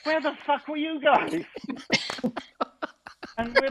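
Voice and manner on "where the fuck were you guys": high, indignant where-were-you; mock outrage at late friends; high-pitched indignant demand; Lenny's indignant shout. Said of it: "and he goes".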